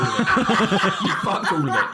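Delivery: laughing while telling him off. Real, not acted. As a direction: giggle through the words. Laughing at his attempt.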